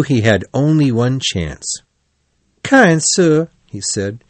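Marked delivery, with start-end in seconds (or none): 2.6-3.5 higher-pitched appeal; anxious plea, pitched up; pleading. A narrator's reading. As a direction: beg a favour humbly. Toad begs driver for help.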